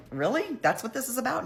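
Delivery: high incredulous Catherine. Retold or imitated. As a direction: jump up high in disbelief. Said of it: "Catherine's like".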